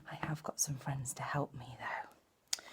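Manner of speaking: put-on hushed whisper to the children. Storyteller confiding to little listeners, whispering. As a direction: let them in on a secret.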